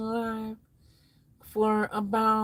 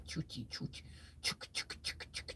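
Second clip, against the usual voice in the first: whispered choo-chee engine sound; soft imitated motor chug; engine chugging noise; 7 semitones lower, 18 dB quieter, faster than usual.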